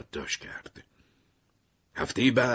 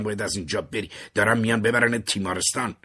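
a mild, half-raised urgent call; urgent warning, somewhat louder; urgent shout; 5 semitones higher, 6 dB louder than usual. A narrator's reading. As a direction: warn him, a bit louder. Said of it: "داد زدم".

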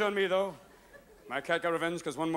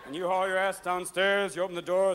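usual speaking voice

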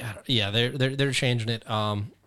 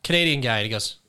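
usual speaking voice

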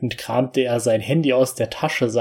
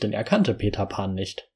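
ordinary delivery

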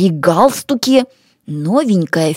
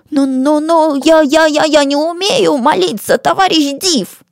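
high, stammering plea; scared high-pitched character voice; frightened; 8 semitones higher than usual. A narrator's reading. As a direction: plead in panic, stuttering the first words. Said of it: "Он испуганно упал на колени".

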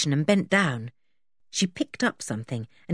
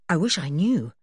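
ordinary delivery, nothing marked

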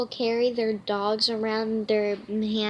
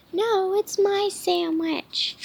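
high, slow protest; protesting refusal; 8 semitones higher than usual. A narrator's reading. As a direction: draw out a high-pitched refusal. Hugo refuses to give up sandwich.

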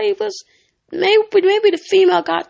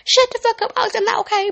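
mock snapping girlfriend; 8 semitones higher than usual.

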